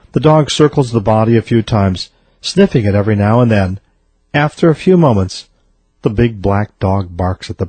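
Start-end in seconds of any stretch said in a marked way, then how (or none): none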